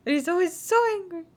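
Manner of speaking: a light angry impression; imitating Keynote. Speaker imitates Keynote's angry talking. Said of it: "he just kinda talks like this".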